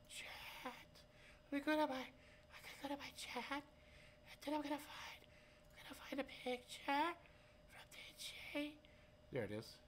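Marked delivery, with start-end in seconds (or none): 0.1-8.7 high, playful cartoon mumble; high silly voice. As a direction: goof around in a squeaky voice. Silly voice while navigating screen.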